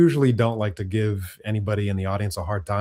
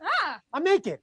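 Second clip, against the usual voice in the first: very high startled cry; startled shriek; slower than usual. Acted. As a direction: yelp as if caught undressed. Sketch startled character.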